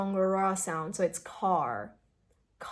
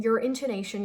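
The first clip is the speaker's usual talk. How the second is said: ordinary delivery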